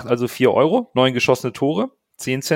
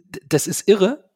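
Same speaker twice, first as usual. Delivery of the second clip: begeistert staunend; 9 semitones higher than usual. Real, not acted. Staunt über den Kantersieg.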